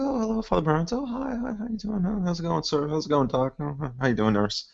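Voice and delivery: high, chirpy greeting voice; greetings in passing. Acted. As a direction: greet everyone with fake cheer. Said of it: "it's like".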